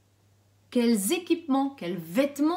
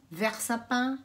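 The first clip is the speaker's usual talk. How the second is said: ordinary talk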